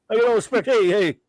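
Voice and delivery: lightly done Dangerfield catchphrase; Rodney Dangerfield impression. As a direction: complain like a heckled old comic. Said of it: "pretty good Rodney Dangerfield impression".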